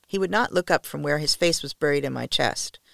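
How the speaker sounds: ordinary delivery, nothing marked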